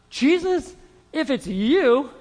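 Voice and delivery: calling Peter. Acted. Peter calling to Jesus from the boat, tentative call. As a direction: call out haltingly to someone across the water.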